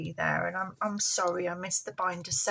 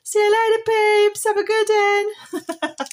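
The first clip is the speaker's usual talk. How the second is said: high puppet voice; 11 semitones higher, 7 dB louder than usual. Pretend. Puppet Polly says goodbye.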